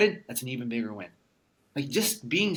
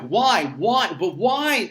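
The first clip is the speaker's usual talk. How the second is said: loud, higher pleading whys; questioning; 5 semitones higher, 7 dB louder, much slower than usual. Retold or imitated. Questioning fate after the crash, loud and high. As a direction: demand an answer from the sky. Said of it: "And I'm questioning".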